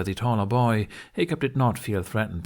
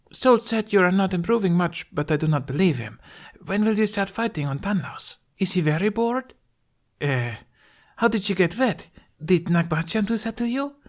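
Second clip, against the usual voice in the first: put-on much higher girl's voice, eager questions; chatty girl voice; 11 semitones higher than usual. A narrator's reading. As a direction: play a curious, eager young girl. Girl character chattering at Hiccup.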